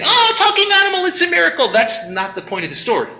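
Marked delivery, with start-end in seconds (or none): 0.0-1.7 much higher, fast gushing wonder; mock amazement. Believers gushing over the talking animal, hammed-up wonder. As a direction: marvel like a naive believer. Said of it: "Or when they say".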